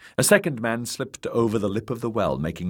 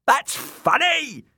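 slow, high, gleeful crow; 4 dB louder, slower than usual. A narrator's reading. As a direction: gloat like a mad jester. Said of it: "Shagwell crowed".

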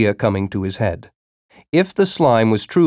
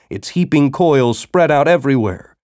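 ordinary delivery

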